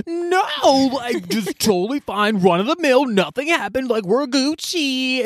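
high, slow, carefree mock local; airy high denial, dragging the words; breezy denial. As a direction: brush it off sing-song high. Guy insisting nothing happened.

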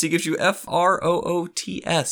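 F R O O T S spelled letter by letter. Shown as a demonstration. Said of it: "I want to specify when I say fruits".

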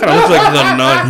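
high, loud words through laughter; real laugh bursting through the words. Real, not acted. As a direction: say it while cracking up. Laughing at his own comparison.

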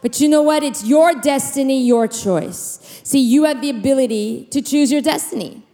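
high, urgent preaching voice; full-force preaching. Acted. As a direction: lift the congregation with fervour.

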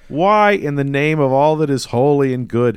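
high, loud, incredulous rant; exasperated outburst. Real, not acted. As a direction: plead loudly in real exasperation. Ranting about living with Paulie.

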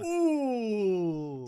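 long, high, loud impressed ooh. Real, not acted. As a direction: react like it's juicy gossip. Friend reacts to the email.

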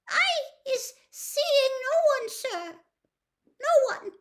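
very high, halting, squeaky denial; frightened elf. A narrator's reading. Trembling house-elf answering Mister Diggory, frightened and squeaky. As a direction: squeak it out after a gulp. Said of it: "began to tremble worse than ever".

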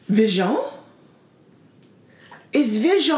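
imitating a child's French accent; French-accented, second syllable stressed. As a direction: say it as a little would-be French speaker. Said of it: "where she got this French accent from".

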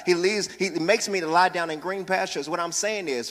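ordinary delivery

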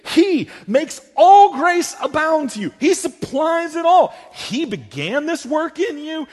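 high, loud, driving sermon build; soaring pulpit cry; fervent preaching. Acted. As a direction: preach it full force, lifting the crowd. Preacher building to full force.

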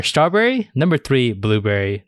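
usual speaking voice

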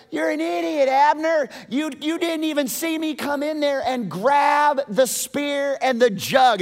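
high-pitched scornful holler; taunting. Acted. David showing Abner the stolen spear, taunting shout. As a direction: rub it in, loudly and high. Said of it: "He taunts Abner".

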